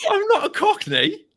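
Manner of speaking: high, loud laughing objection; laughing protest. Real, not acted. Host objecting to co-host's accent impression, laughing protest. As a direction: protest in a high voice while cracking up.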